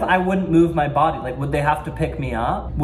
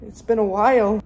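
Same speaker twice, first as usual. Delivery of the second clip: high, giggly pretend chat; coy small talk; 7 semitones higher than usual. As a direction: giggle shyly through polite chitchat. Pretend chat with imaginary stranger.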